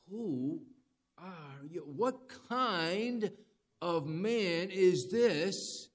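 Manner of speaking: high, drawn-out amazement; stretched-out, astonished questioning; awestruck. Acted. Acts out awestruck disciples' question.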